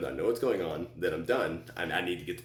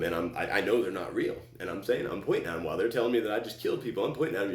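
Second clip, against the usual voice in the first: usual speaking voice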